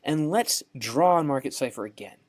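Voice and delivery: normal talking voice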